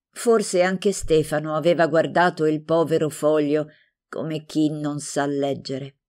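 ordinary delivery

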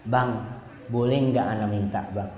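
low, soft wifely plea; gentle request. Acted. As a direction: ask softly and humbly. (meminta dengan suara rendah dan lembut)

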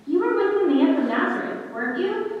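questioning. A narrator's reading. Servant challenging Peter by the fire, questioning. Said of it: "she questioned".

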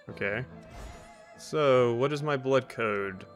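normal talking voice

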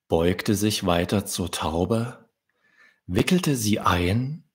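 plain reading voice

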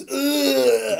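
long high "ugh" of a fake death; exaggerated dying noise; mock dying groan. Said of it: "over his master's body and he goes".

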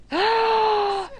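sharp high gasp; shocked gasp. Real, not acted. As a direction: suck in air in fright. Sees snake teeth.

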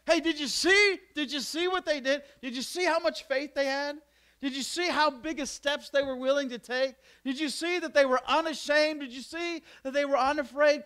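high, loud, urgent questions; excited. Acted. Preacher urging the crowd, in excited high questions.

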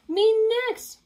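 high, loud eager shout; eager cry. Acted. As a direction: call out to go first. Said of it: "cried Spike".

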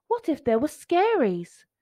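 ordinary delivery

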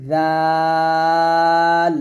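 জাল with a light, soft z and long held vowel; thin, soft letter. Shown as a demonstration. Teacher shows the soft letter জাল, thin and drawn out. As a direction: stretch জাল out slowly, keeping it thin. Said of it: "পাতলা".

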